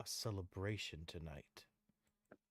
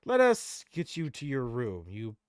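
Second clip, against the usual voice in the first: louder, stern brotherly voice; Tyrael's voice; 4 semitones higher, 12 dB louder than usual. Acted. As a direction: speak as a weary angel leader. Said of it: "he says".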